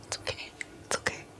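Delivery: whispered gentle reassurance; soothing whisper. Acted. Streamer whispering comfort to Nanners the plush. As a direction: hush it softly.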